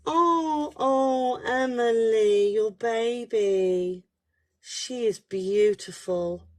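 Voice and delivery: high, drawn-out tender "oh". Real, not acted. Psychic reacting to a viewer's baby, tender and moved.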